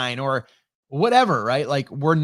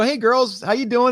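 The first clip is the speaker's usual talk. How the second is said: high, raised call down to the pond; calling cheerfully down to the girls; yelling a friendly hello down to the girls; 9 semitones higher, 4 dB louder than usual. Retold or imitated. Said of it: "I go".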